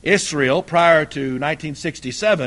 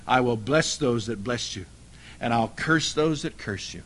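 hushed solemn quote; 8 semitones lower, 7 dB quieter than usual. Shown as a demonstration. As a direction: lower and softer, a solemn hush. Repeats God's promise more solemnly.

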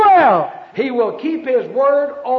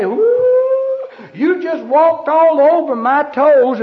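high mock ooh, then louder protest; stung churchgoer; 5 semitones higher, 5 dB louder, slower than usual. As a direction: yelp like your toes got stepped on.

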